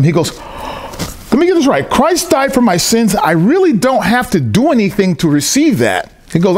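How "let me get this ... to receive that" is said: imitating astonishment in a high voice; amazed recap. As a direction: repeat it back incredulously, pitched up. Acting out man's amazed recap.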